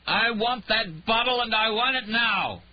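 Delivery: loud, high, slow, slurred bellow; drunken demand. Acted. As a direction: roar it from behind the door, drunk and furious. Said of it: "From behind the bedroom door, she heard".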